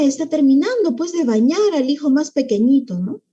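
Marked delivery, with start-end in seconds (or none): none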